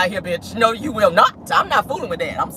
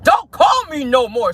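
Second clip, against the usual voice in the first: mock angry yell, drawn out and higher; retold yell; 5 semitones higher, 3 dB louder, slower than usual. Woman telling him off on the phone, mock yelling. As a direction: yell it slowly, imitating her on the phone. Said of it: "she is yelling at his ass in the phone".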